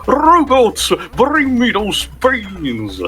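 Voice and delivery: high call, then slow and drawn out; evil villain command. Acted. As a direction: order the robots like a gloating villain. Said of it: "with your evil monologue".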